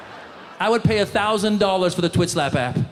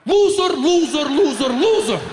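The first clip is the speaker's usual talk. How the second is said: louder, higher furious repeated insult; angry uncle; 7 semitones higher, 4 dB louder than usual. Retold or imitated. Chechen uncle at the press conference: furious repeated yell. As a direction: hurl it at the reporters. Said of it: "Can you elaborate?".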